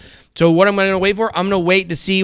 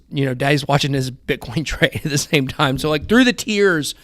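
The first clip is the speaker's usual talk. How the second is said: usual speaking voice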